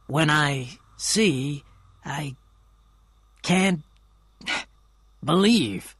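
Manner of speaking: very slow, halting words; slowly. A narrator's reading. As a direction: let each word hang, stunned. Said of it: "Gatsby said slowly".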